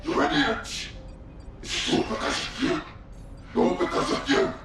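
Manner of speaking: ragged, breathy blaming; furious accusation through a whispery rasp; bitter accusation. Acted. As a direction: hurl blame in a broken half-voice. Anguished voice blames others in recording.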